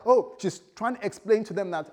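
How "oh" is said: high, loud startled oh; hammed-up shock; mock shock. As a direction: church member caught by collectors, mock shock. She protests to collectors.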